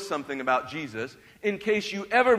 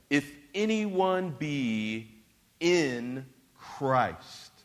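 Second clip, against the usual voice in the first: slow, hushed reading of the verses; scripture reading; 6 semitones lower, 5 dB quieter, slower than usual. Acted. Pastor reading Scripture to the congregation, slow and hushed. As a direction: let each line of scripture land slowly.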